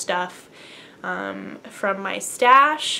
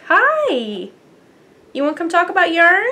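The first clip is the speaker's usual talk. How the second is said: high, loud pet-greeting voice; cooing to pet; 8 semitones higher, 9 dB louder than usual. Real, not acted. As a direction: call the cat over in a lilting, higher voice. Greeting the cat that wanders in.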